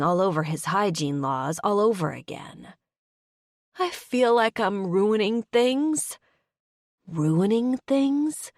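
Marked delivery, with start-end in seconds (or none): 3.8-6.2 slow, higher, tearful confession. Acted. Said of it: "she bursts into tears". 7.1-8.5 slow, higher, puzzled "Ruining things?"; baffled echo. Acted. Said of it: "he echoes, baffled".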